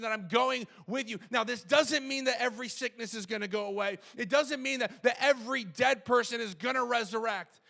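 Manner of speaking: loud, high, impassioned sermon; forceful preacher proclaiming over the congregation; full-force preaching. Acted. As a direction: drive each phrase home at full voice.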